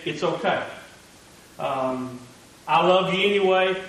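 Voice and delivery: soft, low and gentle; softly spoken forgiveness to a guilty friend; tender reassurance. Acted. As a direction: comfort him quietly, like a forgiving friend. Jesus' gentle words to Peter.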